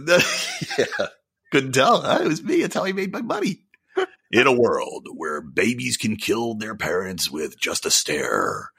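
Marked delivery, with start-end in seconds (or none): 4.3-8.7 slow, deep epic trailer narrator; mock action movie trailer voice. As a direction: draw it out like a dramatic trailer. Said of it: "that guy had a great voice too".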